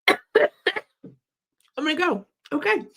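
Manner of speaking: high, strained voice after a cough; real cough-choked voice. Real, not acted. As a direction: struggle to get words out mid coughing fit. Coughing fit forces her away.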